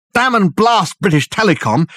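high, loud exasperated shout. A narrator's reading. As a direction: curse it out loud. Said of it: "shouted Dirk".

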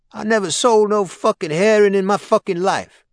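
high, loud, outraged denial; a cornered hustler yelling back; high loud protest of innocence; indignant dealer. Acted. Accused dealer denies angrily.

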